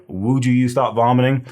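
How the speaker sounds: mock telling-off. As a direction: tell off someone in a mnemonic skit. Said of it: "you'd be like".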